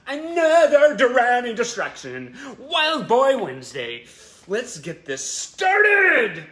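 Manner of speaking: loud, high, slow hyped announcer call; hype intro shout. Acted. Host kicking off the show for her fans, loud high hyped shout.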